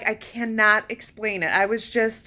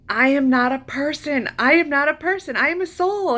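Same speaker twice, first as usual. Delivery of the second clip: ecstatic declaration; 7 semitones higher than usual. Retold or imitated. Sobbing with joy at realization.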